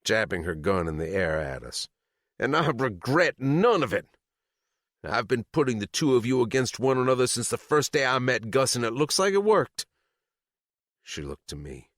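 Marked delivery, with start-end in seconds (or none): 2.4-9.9 gloating. A narrator's reading. Sadie gloating.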